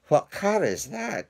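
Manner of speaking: scandalised guest crying out; outraged cry. Acted. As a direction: squeal it in disgust. Another diner shouting.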